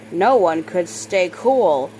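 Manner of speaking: plain reading voice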